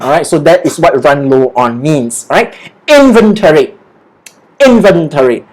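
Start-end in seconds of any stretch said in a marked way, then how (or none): 2.9-5.5 "Inventory" punched on the first syllable; stress on IN. Shown as a demonstration. Teacher models for learners, "Inventory" stressed on "In". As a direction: hit "In" hard, let the rest fall. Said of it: "The stress is on the word in".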